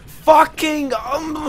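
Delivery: high, loud yelp of annoyance; frustrated outburst. Real, not acted. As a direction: curse out loud at yourself.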